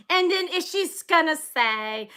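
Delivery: ordinary talk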